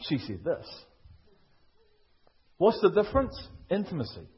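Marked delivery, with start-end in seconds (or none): 0.0-0.8 soft and low, knowing; quiet knowing correction. Shown as a demonstration. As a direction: say She said this. quietly, lower, as the husband who knows. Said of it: "She didn't say that".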